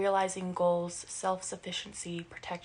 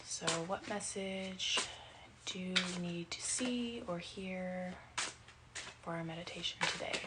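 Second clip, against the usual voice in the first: hushed question; 7 dB quieter than usual. Real, not acted. Asking the cards a question.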